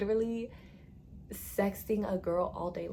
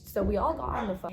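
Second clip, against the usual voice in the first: normal talking voice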